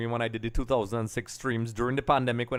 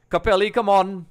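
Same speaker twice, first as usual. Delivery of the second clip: higher, louder call to a brand; loud appeal; 7 semitones higher, 9 dB louder than usual. Real, not acted. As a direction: call out in a raised, pleading voice. Calls on Capelli for a jersey.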